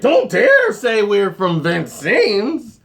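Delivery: mock the uppity neighbors; hammed-up snooty Beverly resident; snooty locals. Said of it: "people on Beverly like".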